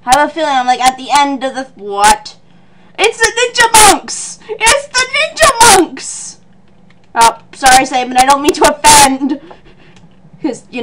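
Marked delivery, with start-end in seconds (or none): 3.0-6.4 high, drawn-out hammy exclamation; thrilled high-pitched cry; excited announcing. Real, not acted. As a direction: hail their arrival in a high singsong. Excited reveal of enemies.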